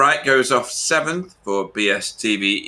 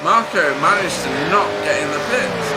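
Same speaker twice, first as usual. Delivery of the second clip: excited; 6 semitones higher than usual. Real, not acted. Arguing about who pitted.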